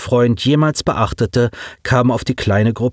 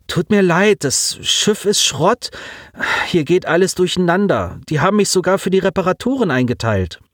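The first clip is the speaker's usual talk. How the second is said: put-on higher young voice, sorry and stressed; hurried apology over chaos; Simon, apologetic; 8 semitones higher than usual. A narrator's reading. Simon tells Colin over radio, higher, apologetic. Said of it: "Simon speaking over radio".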